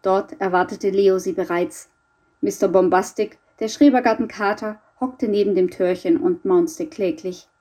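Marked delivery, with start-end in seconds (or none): none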